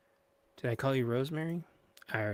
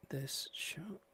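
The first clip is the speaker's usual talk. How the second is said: whispered aside; 4 semitones higher, 8 dB quieter than usual. Real, not acted. Streamer whispering to self while setting up the show. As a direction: whisper it to yourself.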